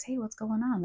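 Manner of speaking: normal talking voice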